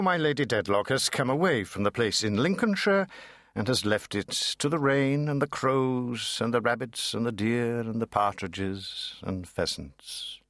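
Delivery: usual speaking voice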